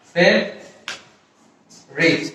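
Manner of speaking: ফেভারিট as "fev", long pause, "rit"; broken-up pronunciation. Shown as a demonstration. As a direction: split it slowly, fev... rit. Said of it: "শব্দটা হচ্ছে". (ধীরে ভেঙে ফেভারিট: ফেভ... রিট)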